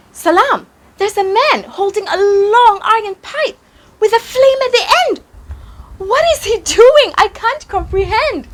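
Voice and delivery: put-on high-pitched, quick child full of wonder; puzzled, excited little kid voice; amazed child. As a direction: exclaim in a high voice, marvelling at a strange sight. Child character wonders at glassblower.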